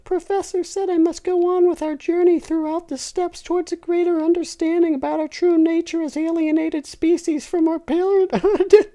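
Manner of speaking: high, loud mock-haughty falsetto; condescending voice. Dipsy refusing his friend, condescending falsetto. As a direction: talk down to him in falsetto. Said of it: "said Dipsy with a condescending voice".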